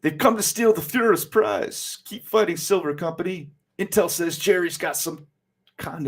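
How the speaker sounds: high, urgent comic-book soldier voice; wartime soldier. Acted. A soldier urges his company to keep fighting, high and urgent.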